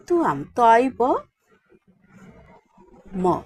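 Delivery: normal talking voice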